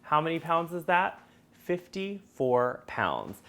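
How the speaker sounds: normal talking voice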